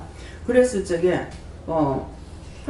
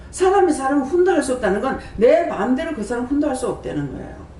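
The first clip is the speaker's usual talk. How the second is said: fervent preaching; 5 semitones higher than usual. Acted. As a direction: press the point to the congregation. Preaching again. (설교자가 신도들에게 열정적으로 높여 외치듯)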